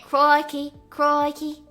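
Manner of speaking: ordinary talk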